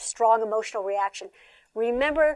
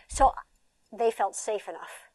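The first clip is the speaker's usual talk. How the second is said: ordinary talk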